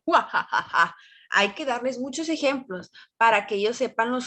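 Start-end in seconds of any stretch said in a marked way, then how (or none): 0.0-1.0 low sinister witch cackle; evil laugh. Shown as a demonstration. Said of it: "cómo es una risa tenebrada de".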